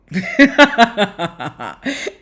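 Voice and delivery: loud real burst of laughter. Real, not acted. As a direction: break into laughter you can't hold back. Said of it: "I shouldn't laugh".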